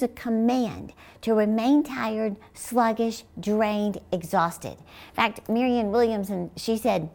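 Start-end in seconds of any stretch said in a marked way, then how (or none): none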